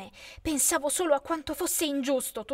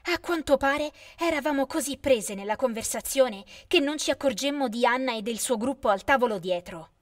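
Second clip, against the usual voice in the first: plain reading voice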